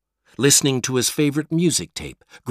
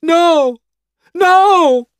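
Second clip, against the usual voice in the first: much higher, much louder cries of no; desperate shout; 11 dB louder, much slower than usual. Acted. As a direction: scream it as she collapses. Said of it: "Peter shouted".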